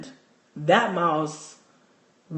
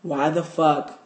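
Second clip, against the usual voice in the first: normal talking voice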